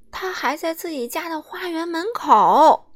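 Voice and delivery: ordinary delivery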